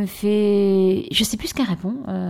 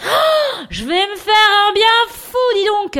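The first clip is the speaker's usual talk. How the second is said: gasp, then much higher and louder glee; delighted high exclamation; gleeful; 11 semitones higher, 6 dB louder than usual. Real, not acted. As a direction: relish the idea.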